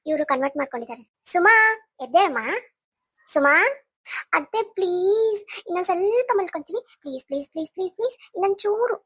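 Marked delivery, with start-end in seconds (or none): 1.2-3.5 louder rising call of the name. Acted. Aunt calling sleeping niece to wake up, loud call. 4.3-9.0 sleepy pleading. Acted. Sleepy niece begs for more sleep.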